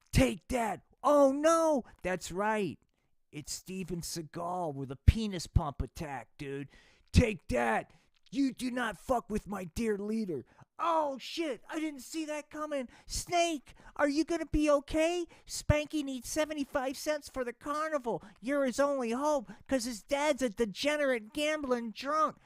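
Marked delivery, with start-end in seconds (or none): 0.1-2.8 higher, louder battle cries; mock action-movie yells; hammed-up fight. Fight scene exclamations. 7.1-10.5 louder, higher tough-guy yell; hammed-up fight shout; mock action hero. Seagal defends Putin. 10.8-22.4 much higher, alarmed squeaky voice; hammed-up high-pitched worry; squeaky panicked voice.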